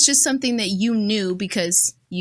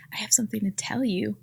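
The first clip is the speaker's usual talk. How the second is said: imitating a neighbor's secretive whisper; hushed, conspiratorial whisper, softer; confiding whisper; 5 dB quieter than usual. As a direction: whisper it like gossip about to spill. Neighbor leaning in with gossip.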